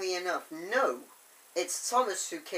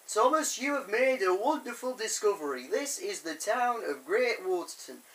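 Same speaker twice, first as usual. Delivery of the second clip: louder, higher proclamation in imitated Leeds accent; 5 semitones higher, 5 dB louder than usual. Fat Controller congratulating Thomas on his discovery, mock Leeds accent. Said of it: "the Fat Controller sort of Leeds accent".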